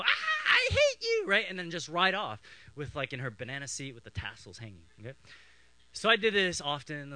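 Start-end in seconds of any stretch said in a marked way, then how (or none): 0.0-1.2 high, loud drawn-out shriek; mock scream. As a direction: shriek it like an outraged little sister. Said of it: "she'll scream and go".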